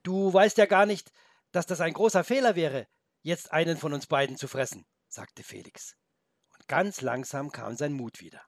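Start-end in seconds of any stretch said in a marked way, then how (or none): 0.0-4.9 put-on higher, louder young boy; boy's voice. The boy tells the wolf it would be a mistake, high and loud.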